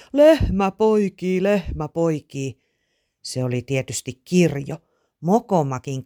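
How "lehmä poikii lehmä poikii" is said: drawn-out, slow holler; slow storybook shout, strongest at the start; calling out news. A narrator's reading. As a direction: call it out slowly from the barn, easing off on the repeat. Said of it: "tulla porhalsi Aatu navetasta ja huusi".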